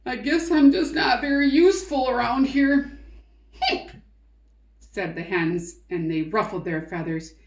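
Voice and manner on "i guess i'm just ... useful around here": mopey peacock. Acted. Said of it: "moped and moaned and groaned".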